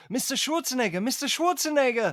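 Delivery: put-on Austrian accent, loud and high; mocking Austrian accent; Schwarzenegger accent. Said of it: "it's just the way he talks".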